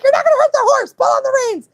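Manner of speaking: high, loud exasperated orders; imitated screaming. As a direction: yell back as the bossy little friend. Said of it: "screaming in my face".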